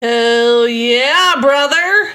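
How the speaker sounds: loud playful cheer; excited cheer. Real, not acted. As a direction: whoop it like a fan hyping a hero. Cheering the girl boss ghost.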